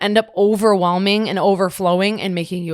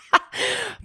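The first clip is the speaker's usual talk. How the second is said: short high giggling laugh; burst of laughter. Real, not acted. Caught pointing upward, she bursts out laughing. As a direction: laugh at yourself.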